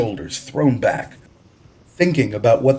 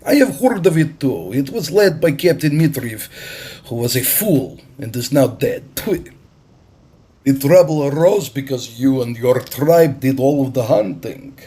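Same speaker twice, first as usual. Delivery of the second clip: higher, louder, commanding stranger; bold Russian captain; 4 semitones higher, 4 dB louder than usual. A narrator's reading. Russian captain to the island chief, higher and louder. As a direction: announce it like a man who owns the harbor.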